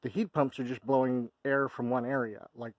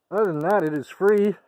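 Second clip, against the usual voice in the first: excited; 8 semitones higher, 11 dB louder than usual. Real, not acted. Triumphant announcement.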